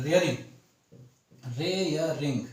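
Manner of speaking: रेयरिंग low and measured, first syllable "ray"; spelled-out pronunciation. Shown as a demonstration. Teacher writes it out in Hindi, रेयरिंग sounded out as "ray-ya-ring". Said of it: "ऐसे लिखेंगे".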